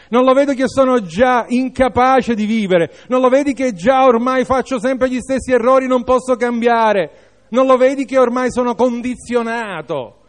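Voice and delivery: high, urgent, rapid-fire protest; hurried, high-pitched despairing objection; protesting inner thought. Acted. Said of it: "qualche tuo pensiero ti dirà".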